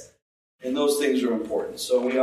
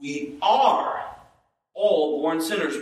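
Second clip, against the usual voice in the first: "are" pitched up and followed by a pause; stress on are; slower than usual. Shown as a demonstration. Preacher showing each word matters, stress on "are". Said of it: "Any word we could emphasize there".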